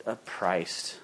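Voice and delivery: the word breaks as the voice fails; voice giving out. Real, not acted. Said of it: "Can't even speak".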